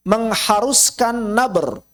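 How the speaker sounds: ordinary talk, nothing marked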